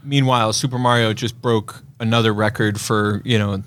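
normal talking voice

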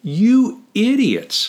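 high, drawn-out playful insult; mock exasperation. Liberally translating Paul's rebuke to readers, mock-exasperated. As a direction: stretch it out high, as if astonished at them. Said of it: "I'll translate that liberally".

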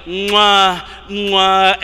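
two loud smacking kiss noises; kissing smacks. Shown as a demonstration. Preacher demonstrating the Hebrew kiss sound, loud smacks. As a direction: smack the lips like a big kiss. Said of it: "it's like".

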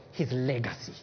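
preacher lowering his voice to unmask envy; hushed low. Real, not acted. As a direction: drop to a grave hush. Sudden hush after loud part.

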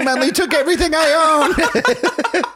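high, laughing wail about losing everything; laughing lament. Retold or imitated. Friend voicing the ruined insurance guy, laughing wail. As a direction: wail it while cracking up.

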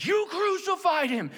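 high, drawn-out accusing cry; preaching accusation. Retold or imitated. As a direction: hurl the charge as Peter, slowly. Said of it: "When Peter said".

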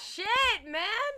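high, loud amazed outburst; stunned. Real, not acted. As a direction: blurt it out in shock. Stunned by the color fact.

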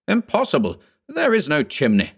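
high, emphatic contradiction; indignant denial. A narrator's reading. Lawyer corrects Anderson about the chimney, indignant denial.

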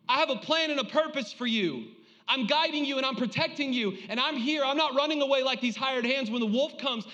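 high, driving sermon delivery; impassioned preaching. Real, not acted. Preacher assuring the congregation God knows them by name, impassioned preaching. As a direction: preach it with urgent conviction.

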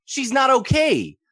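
high, slow and loud; emphatic. Real, not acted. Teacher stressing Sarah's jealousy, slow and high. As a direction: lean hard on every word.

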